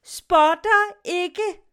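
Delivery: loud, slow, outraged denial; insulted sailor shouting back; indignant shout. Acted. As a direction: bellow it out in protest. Sailor flares up in protest.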